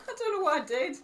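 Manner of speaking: ordinary talk, nothing marked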